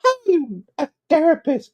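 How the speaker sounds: very high tipsy falsetto; hammed-up drunken lady complaint; drunk weird lady. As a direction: scold a friend in a squeaky drunk voice. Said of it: "she's like".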